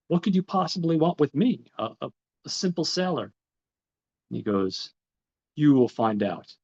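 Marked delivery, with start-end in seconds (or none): 0.1-3.3 higher and louder, bewildered protest; who, me? a simple sailor?; startled disbelief. Retold or imitated. Edmond stunned by guards seeking him. 5.6-6.6 a louder, ominous reply; stern guard. Retold or imitated. Guard answers Edmond curtly.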